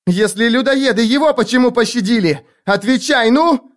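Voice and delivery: put-on very high, accusing girl; furious demand. A narrator's reading. Girl interrogating the stammerer about the survivor, furious demand. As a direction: press for an answer, climbing at the end.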